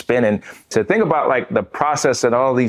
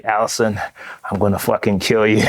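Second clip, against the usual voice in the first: ordinary talk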